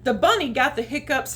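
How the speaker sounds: ordinary delivery, nothing marked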